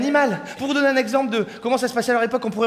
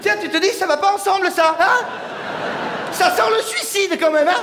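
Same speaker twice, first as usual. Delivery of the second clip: raised, loud mock warning; man holding razor and balls; high mock alarm; 8 semitones higher, 3 dB louder than usual. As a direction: squeak it with fake worry.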